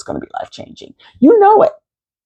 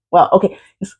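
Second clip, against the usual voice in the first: usual speaking voice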